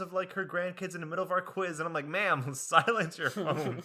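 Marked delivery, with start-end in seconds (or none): 2.1-3.8 laughing scold. Retold or imitated.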